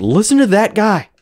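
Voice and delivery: high-pitched imitated moviegoer; exclaiming as the audience; mock audience reaction. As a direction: pitch it up like someone pointing out that guy. Voices a horror audience's reaction.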